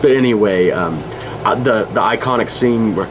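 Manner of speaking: ordinary delivery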